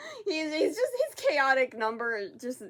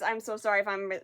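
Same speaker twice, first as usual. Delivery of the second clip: ordinary talk, nothing marked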